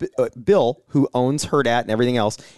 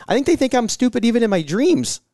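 ordinary talk, nothing marked

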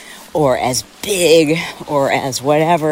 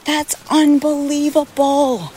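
amazed; 11 semitones higher than usual. Real, not acted. Amazed at her family.

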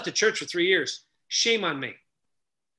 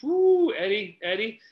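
stunned; 4 semitones higher, slower than usual. Retold or imitated. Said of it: "I'm like".

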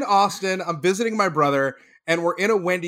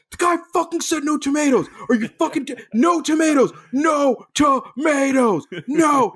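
much higher, louder angry yell, slowing on the repeats; furious yelling; 10 semitones higher, 4 dB louder than usual. Retold or imitated. Friend in back seat yelling at drive-thru worker, enraged shout. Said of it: "Koo leans forward into the speaker".

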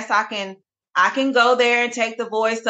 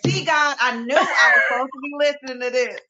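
excited realization; 6 semitones higher, faster than usual. Retold or imitated. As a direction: burst out with joyful recognition. Retelling excited talk to God.

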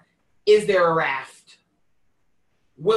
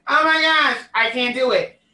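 mock dismay; 6 semitones higher, 5 dB louder than usual. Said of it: "I always be like".

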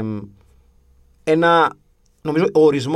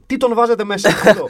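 higher, indignant comeback; instant retort; 6 semitones higher, 4 dB louder than usual. Retold or imitated. Fan answering back to the coaching staff, indignant retort. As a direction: fire back without missing a breath. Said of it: "χωρίς να χάσω ανάσα".

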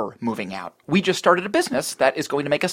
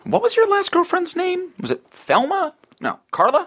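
mock guessing. Mocking guesses of girlfriend names.

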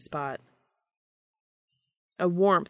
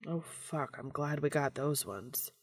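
breathless and quiet; 8 dB quieter than usual. A narrator's reading. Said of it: "he managed".

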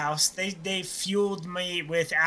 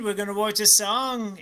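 ordinary talk, nothing marked

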